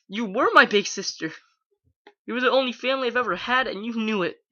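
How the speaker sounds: high, tearful shouting at her big sister; crying and shouting. A narrator's reading. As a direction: shout through tears, pleading and betrayed. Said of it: "Her throat hurt from the crying and shouting".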